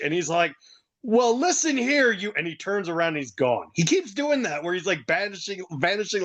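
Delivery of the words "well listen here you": higher, slow mock scolding; acted telling-off, cut short; indignant retort. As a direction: start a slow, raised-pitch mock rebuke. Acting out cut-off angry retort.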